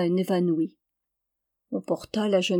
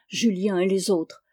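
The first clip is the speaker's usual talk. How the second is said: ordinary delivery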